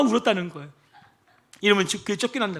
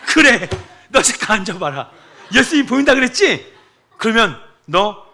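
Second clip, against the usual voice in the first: louder, slow seething challenge; fuming pastor; 5 semitones higher, 10 dB louder, slower than usual. Retold or imitated. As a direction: lay down the challenge in anger. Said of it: "뚜껑이 활짝 열려서".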